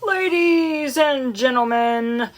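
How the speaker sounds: slow, formal PA voice; stately public address opening; loudspeaker announcement. A narrator's reading. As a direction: announce it over a fair loudspeaker. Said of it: "continued the loudspeaker".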